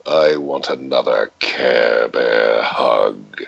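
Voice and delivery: deep, slow growly hug line; low slow character voice. Shown as a demonstration. Voice actor quoting his deep character, low and slow. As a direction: drop deep and slow for a big gruff character.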